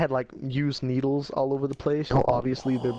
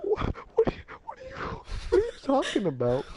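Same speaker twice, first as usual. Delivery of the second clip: much higher, breathless frightened squeak; terrified squeak; faster than usual. Retold or imitated. Scared eleven-year-old asks the thug, panicked high squeak. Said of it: "And I'm like".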